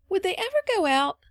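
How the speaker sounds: put-on high-pitched, earnest child's question; grave child. A narrator's reading. Phronsie asking Polly about candles, grave. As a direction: ask it earnestly in a high little voice. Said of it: "asked the child gravely".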